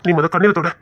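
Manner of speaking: usual speaking voice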